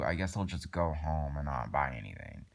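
usual speaking voice

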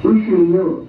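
ordinary delivery

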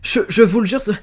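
high, loud, stammering oath; hasty reply. A narrator's reading. Servant girl swearing to her master, hurriedly. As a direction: trip over the words in panic. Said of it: "Elle répondit précipitamment".

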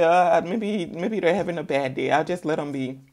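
ordinary talk, nothing marked

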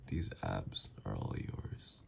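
soft, low, breath-heavy whisper; hushed devotion; intimate flirting. Acted. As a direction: whisper it close, for the partner alone.

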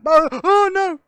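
sudden alarmed cry; surprised. Real, not acted. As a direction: yelp of dismay. Sudden setback in game.

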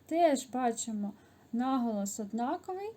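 normal talking voice

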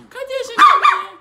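agudo e alto, afetado; voz de bebê. Real, not acted. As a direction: fale com o cão como com um bebê. Fala com o cão, vozinha. (vozinha aguda e alta para o pet)

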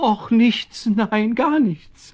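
high, slow, nervous backpedalling; flustered denial. Acted. Man answering a talking cat, high and flustered. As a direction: stammer it out high and anxious.

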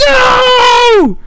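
high, loud wail of no; despairing shout. Retold or imitated. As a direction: scream it like losing everything. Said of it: "so LeBron was like".

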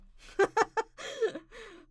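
high, loud laugh; loud laughter. Real, not acted. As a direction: burst out laughing. Laughs at her own joke.

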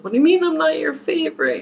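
a hammed-up wounded partner; mock upset husband. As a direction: play the husband hurt and protesting. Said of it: "He'd be like".

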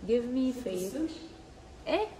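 normal talking voice

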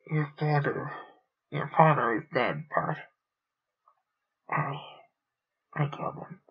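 hoarse confession that trails into a whisper; choked with emotion. A narrator's reading. Raynor confessing to Bart he killed his father, choked up. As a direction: push the words out through grief, the last ones barely voiced. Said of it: "the hoarse words came, choked with emotion".

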